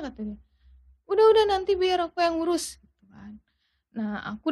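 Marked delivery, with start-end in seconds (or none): 1.1-2.8 high-pitched, hurried-sounding reassurance; imitated insistent soothing; retold placating father. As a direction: voice a father taking charge to calm things. Retold father calming mother.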